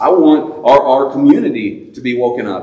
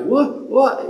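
put-on high, puzzled teen voice; groggy son; 13 semitones higher than usual. Son answering his dad from downstairs, high mock groggy. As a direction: play the sleepy boy. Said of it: "he's like".

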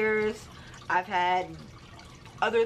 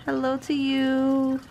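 drawn-out greeting; 5 semitones higher, 5 dB louder, slower than usual. Acted. Greeting doll, slow loud.

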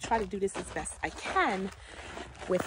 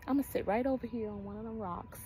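usual speaking voice

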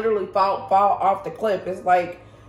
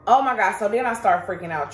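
usual speaking voice

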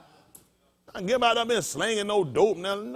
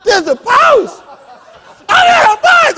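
loud, high-pitched excited shout; excited woman's outburst; 13 dB louder than usual. Retold or imitated. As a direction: squeal it out like an overexcited woman.

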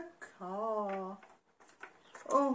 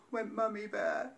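ordinary delivery, nothing marked

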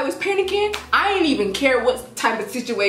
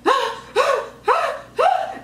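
much higher mock panicked ah ah; panicking cries; slower than usual. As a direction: imitate the cousin's frantic yelps. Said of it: "So she starts panicking".

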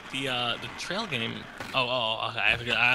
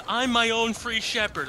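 mock scripture reading; 8 semitones higher, slower than usual.

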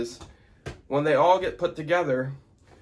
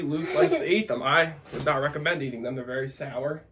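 usual speaking voice

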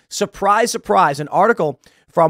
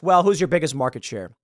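ordinary talk

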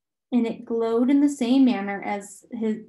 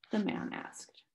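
ordinary delivery

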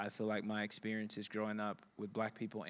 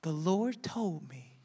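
put-on slow, high, reverent old woman; solemn old lady; 7 semitones higher, 4 dB louder, slower than usual. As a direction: deliver it like a prophecy. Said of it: "She says".

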